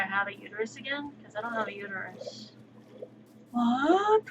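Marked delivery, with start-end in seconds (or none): 3.5-4.2 surprised. Real, not acted. Shocked at no-uterus claim.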